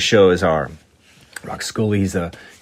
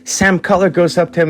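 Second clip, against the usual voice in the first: normal talking voice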